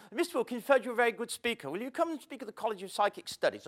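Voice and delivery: prim, quick imitation of the college lady; lightly voiced posh caller, a bit fast; imitating the caller. Speaker mimics the college caller on the phone, lightly. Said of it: "she spoke like this".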